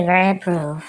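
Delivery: ordinary talk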